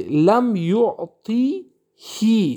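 یعطی هی drawn out slowly with a "hi" ending; slow recited لم یعطی هی; example recited. Shown as a demonstration. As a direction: chant لم یعطی هی slowly with a final "hi". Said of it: "لم یعطی هو نمیگیم".

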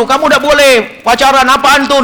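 lightly acted impatient telling-off; hasty reproach. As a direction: jump in with a disapproving challenge. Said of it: "kadang-kadang kita terburu-buru".

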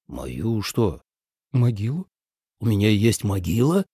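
stunned, halting questions; understated, low-voiced shock; shaken. A narrator's reading. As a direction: ask as if unable to take it in. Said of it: "потрясённо спросил Ли Цзэ".

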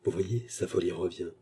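soft, confidential undertone; hushed aside. A narrator's reading. False doctor aside to the maid, hushed. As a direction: drop the voice for an aside. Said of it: "dit tout bas".

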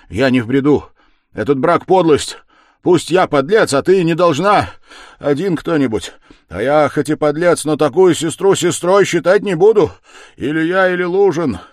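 loud, high, desperate insistence; last effort. A narrator's reading. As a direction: force out each phrase with all remaining strength. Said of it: "собрав последние усилия".

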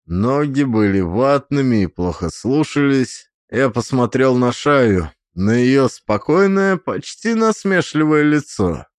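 ordinary delivery

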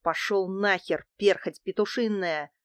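usual speaking voice

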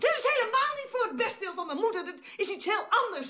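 high-pitched, heated correction; indignant denial. Acted. Acted quarrel, indignant denial.

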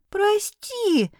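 very high squeaky kitten voice; kitten squeak. A narrator's reading. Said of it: "пискнул котёнок".

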